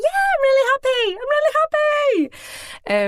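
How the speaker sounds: very high, loud squeal; hammed-up happiness. Pregnant woman assuring the nurse she is fine, hammed-up squeal. Retold unconvincing reassurance to nurse.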